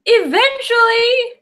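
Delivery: high, slow, crying wail; Sally sobbing. Retold or imitated. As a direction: stretch it out in a weepy wail. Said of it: "And she goes".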